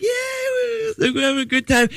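put-on high, squealed happy-drunk cheer. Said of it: "like, a happy drunk".

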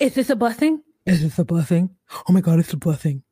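loud, thrilled disbelief; overjoyed bride gasping; excited. Retold or imitated. Quoting Kimberly's thrilled reaction.

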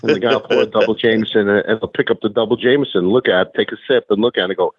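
ordinary talk, nothing marked

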